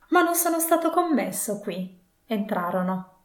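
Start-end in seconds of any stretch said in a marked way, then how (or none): 0.1-2.0 high-pitched, mildly excited exclamation; exclaiming. A narrator's reading. Scrooge to the spirit on seeing the warehouse, high exclamation. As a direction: exclaim it up high, without shouting. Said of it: "esclamò Scrooge".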